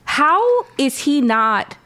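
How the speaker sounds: ordinary delivery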